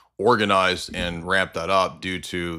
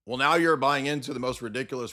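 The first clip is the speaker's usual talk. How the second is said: usual speaking voice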